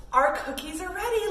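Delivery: put-on playful gasp for the kids; excited gasp. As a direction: gasp like the cookies just finished. Pretend cookies done.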